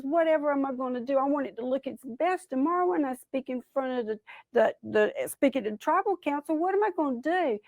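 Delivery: high, hurried fretting; fretting vain Possum. Acted. Possum frets over his tail.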